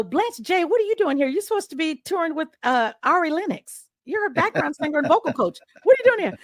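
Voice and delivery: high-pitched amazed questioning; retold astonishment, voice lifted high; surprised greeting. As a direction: demand in happy disbelief why they came. Surprised to find singer there.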